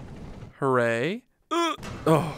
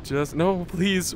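usual speaking voice

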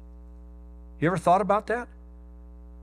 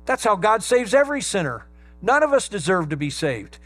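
full-force preaching; 5 semitones higher, 4 dB louder than usual. Acted. Preacher proclaiming mercy and grace to the congregation, ringing. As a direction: build it like a pulpit climax.

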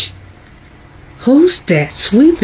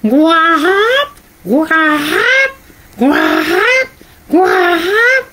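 duck call; 6 semitones higher, slower than usual. Acted. Reader voicing the duck's cry.

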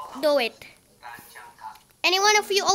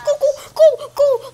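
panicked shouting; 8 semitones higher, 6 dB louder than usual. Real, not acted. Fleeing the monster in panic.

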